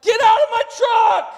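high, loud order to get out; furious yell at the men in the truck bed; shouting. Retold or imitated. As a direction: bellow them out of the truck. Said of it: "he's like".

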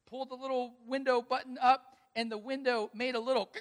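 laughing through the words; can't keep from laughing as you tell it; laughing, a little choked up. Real, not acted.

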